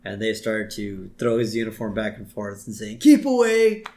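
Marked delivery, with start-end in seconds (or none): 3.0-3.8 high, loud mock taunt; hammed-up teasing shout; teasing call-out. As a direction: call it out like playground bullies. Bullies tossing the uniform.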